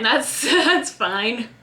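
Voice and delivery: slow, breaking into a laugh; a rueful chuckle through the words. Real, not acted. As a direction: laugh it off, slowly. Repeats with a laugh.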